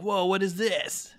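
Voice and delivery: slow, high-pitched mock amazement; awestruck kids. Kids stumbling on Chainsaw Man, drawn-out mock amazement. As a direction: draw it out as an amazed kid. Imagined kids seeing the anime.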